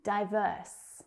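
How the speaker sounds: ordinary talk, nothing marked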